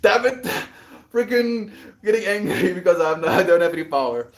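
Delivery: loud, high, exasperated; frustrated outburst. Real, not acted. Venting about having no power, loud and angry. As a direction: blow up in real frustration.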